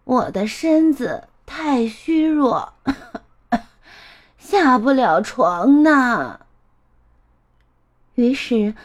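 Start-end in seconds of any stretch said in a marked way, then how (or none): 0.0-6.4 a hint of a weak old woman, last words drawn out; frail grandmother calling. Grandma is too weak to leave bed, calling with effort. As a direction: call with effort from a sickbed. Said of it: "外婆用力喊道".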